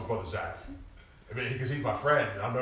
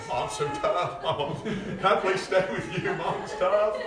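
higher, louder, slow mock whimper with laughs; mock crying kids; 9 semitones higher, 6 dB louder, much slower than usual. Preacher imitates daughters crying to their mother. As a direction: play the sobbing girls for laughs. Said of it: "and they're crying".

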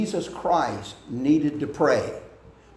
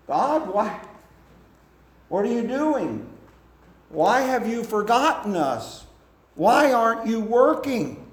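pleading complaint; 10 semitones higher than usual. Acted. Believer crying out to a silent God, high and pleading. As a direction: cry out at God, baffled and hurt.

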